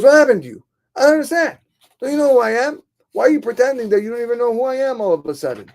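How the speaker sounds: high, loud, bewildered protest; indignant confrontation. Acted. Scholar confronts old man who acts like a stranger, indignant.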